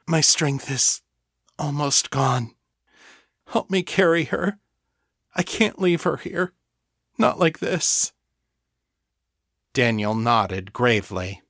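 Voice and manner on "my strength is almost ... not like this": high, strained plea close to tears; a broken voice barely holding together; choked with emotion. Acted. Fiona begging Daniel to help carry her fallen elder, choked. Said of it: "hoarse and choked with emotion".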